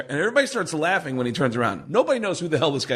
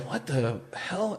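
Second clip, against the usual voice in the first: slow, much softer bewildered mutter; baffled under the breath; 4 semitones higher, 7 dB quieter, slower than usual. Retold or imitated. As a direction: puzzle at the map quietly. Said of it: "I'm looking at it going".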